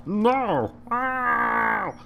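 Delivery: high pained yelps; dismayed cry. Real, not acted. Gamer crying out as the shot goes too far, high yelps. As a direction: yelp at the overshot ball.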